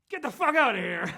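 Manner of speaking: loud, high-pitched exasperated yell; retold cop's shout. Fed-up cop dismisses the Irish gang, shouting loudly. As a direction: bellow it like a cop sending them packing.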